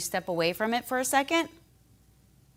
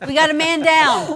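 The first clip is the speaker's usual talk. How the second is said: man down shout; 9 semitones higher, 13 dB louder than usual. Pretend. Cat knocked over, mock alarm.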